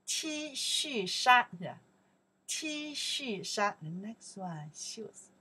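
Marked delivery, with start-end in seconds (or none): 0.1-3.8 T恤衫 spelled out slowly and evenly; slow, careful T恤衫 for repeating; teacher slowing down for the class; slow model word. Shown as a demonstration.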